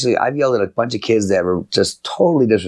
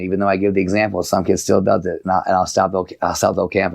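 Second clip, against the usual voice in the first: ordinary delivery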